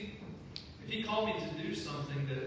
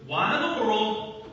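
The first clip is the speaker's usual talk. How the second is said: mock incredulous reply; 3 semitones higher, 9 dB louder than usual. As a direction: stretch out the disbelief. [name] retorts, acted.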